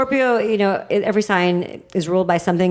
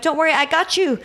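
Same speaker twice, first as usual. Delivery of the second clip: reassuring callout; 9 semitones higher than usual. Retold or imitated. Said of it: "And I'm like".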